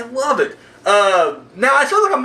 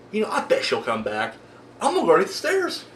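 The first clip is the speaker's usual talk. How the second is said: low, soft scheming killer voice; lightly voiced scheming Jason; sly killer; 4 semitones lower, 6 dB quieter, faster than usual. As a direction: mutter it like a sneaky killer. Voicing Jason plotting.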